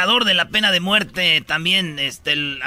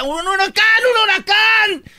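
much higher, louder shrieked warning; panicked scream; 4 dB louder, slower than usual. Retold or imitated. The condemned woman screams at the soldiers in a high panicked yell. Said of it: "Y ella grita".

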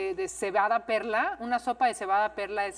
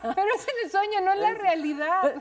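laughing tease; 9 semitones higher, slower than usual. Real, not acted. Teasing friend, laughing.

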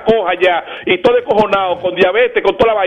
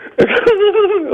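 high laugh; 13 semitones higher than usual. Real, not acted. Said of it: "Tú haciendo acento mexicano".

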